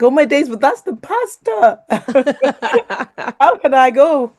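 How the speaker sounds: high, laughing "oh my days"; laughing disbelief. Retold or imitated. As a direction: laugh through the panic at the idea. Panicked reaction to God's instruction.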